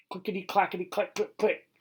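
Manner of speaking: high, quick clickety clacking; clicking racket. A narrator's reading. Narrator imitates the squirrel's racket up the tree, high clattering clicks. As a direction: rattle it off like claws on bark.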